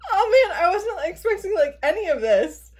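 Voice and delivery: high, emotional gush; squeaky with surprised delight; happily overwhelmed. Real, not acted. Surprised by the gift's contents.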